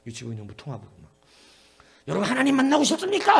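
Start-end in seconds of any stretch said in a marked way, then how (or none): 2.1-3.4 put-on cheery call to kids. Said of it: "했더니 애들이".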